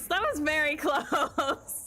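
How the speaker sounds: slow, loud nervous laugh through words; nervous laughter. Real, not acted. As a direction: laugh out the relief. Laughing after near death.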